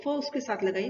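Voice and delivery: normal talking voice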